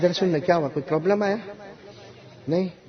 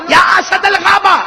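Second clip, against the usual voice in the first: high, slow, loud commanding cry; challenging the lion with a slow, loud cry; bold call to the lion; 13 semitones higher, 8 dB louder, slower than usual. Acted. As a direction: summon your courage and call out. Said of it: "اور کیا کہا معلوم".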